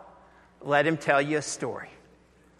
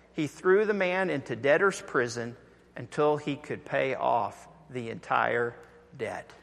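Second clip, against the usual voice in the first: ordinary delivery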